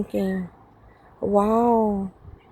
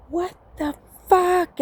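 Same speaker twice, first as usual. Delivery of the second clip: high, slow mock disbelief; retold outrage; 8 semitones higher than usual. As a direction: draw out his indignant outburst, pitched up. Acts out his fit.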